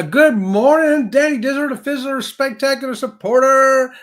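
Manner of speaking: big announcer-style good morning, held long; calling out greeting. Real, not acted. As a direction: hail a supporter at full voice.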